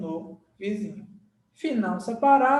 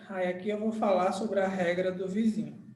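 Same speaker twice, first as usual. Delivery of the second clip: ordinary talk, nothing marked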